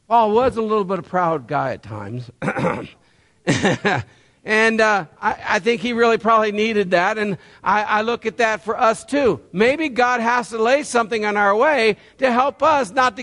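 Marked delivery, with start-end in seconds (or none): none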